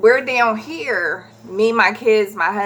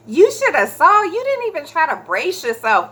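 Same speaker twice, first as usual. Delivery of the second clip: imitated teasing son; 8 semitones higher than usual. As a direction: tease in a pitched-up imitation. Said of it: "Terrence back there laughing at me".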